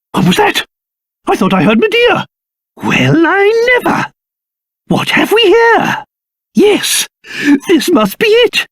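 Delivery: high-pitched astonished exclamations; startled wonder. Acted. Traveller coming upon an ancient ship, amazed. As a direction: marvel at an unexpected discovery.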